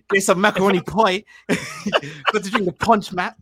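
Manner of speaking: put-on joking Bajan accent about macaroni pie and punch; mock Bajan accent. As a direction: ham up a Barbadian lilt while laughing. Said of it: "could do that accent".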